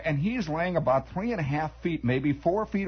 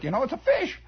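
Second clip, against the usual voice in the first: ordinary delivery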